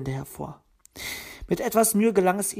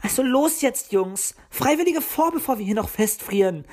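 bossy urging; 7 semitones higher, 4 dB louder than usual. A narrator's reading. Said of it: "meinte Luzi".

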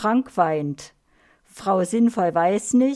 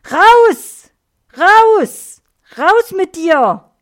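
repeated high, very loud yells; explode, yelling to throw someone out; furious shouting; 10 semitones higher, 13 dB louder, much slower than usual. A narrator's reading. Upset Frank cuts Erna off and throws her out, shouting. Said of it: "schreit Frank".

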